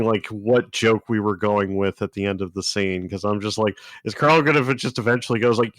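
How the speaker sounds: ordinary talk, nothing marked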